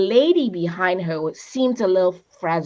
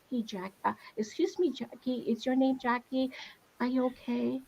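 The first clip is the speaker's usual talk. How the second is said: much softer, hesitant approach; gentle asking; 3 semitones higher, 8 dB quieter than usual. Pretend. Asking a stranger in a café if she's okay, hushed. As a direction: speak softly to someone who might be hurting.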